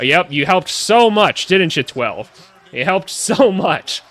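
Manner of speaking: slow, drawn-out mock congratulation; sarcastic praise. Real, not acted. Mocking number twelve's double team, sarcastic praise. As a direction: lay on the fake praise, stretching it out.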